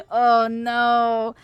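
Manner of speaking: usual speaking voice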